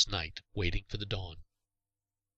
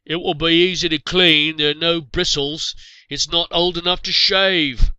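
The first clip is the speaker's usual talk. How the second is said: loud, high and slow, jeering across the camp; a hunter's teasing yell, drawn out and much higher; mocking shout; 8 semitones higher, 12 dB louder than usual. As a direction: bellow the joke so the whole party hears. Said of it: "Some wit shouted".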